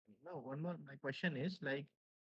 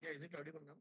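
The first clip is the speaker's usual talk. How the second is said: barely audible; 7 dB quieter, much faster than usual. Real, not acted. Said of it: "Yeah, very low".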